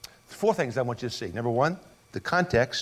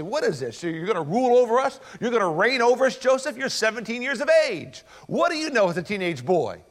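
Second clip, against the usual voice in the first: mocking brothers; 6 semitones higher than usual. Brothers mocking Joseph, acted quote.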